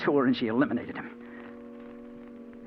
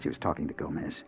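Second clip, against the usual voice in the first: hushed narration; 4 semitones lower than usual. A narrator's reading. Hushed noir narration in dark ward.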